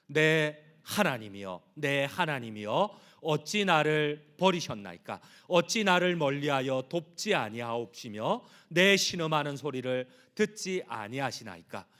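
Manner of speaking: slow, loud, deliberate recitation; slow solemn psalm lament; solemn scripture reading. Acted. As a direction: read each line of the psalm like a cry to God.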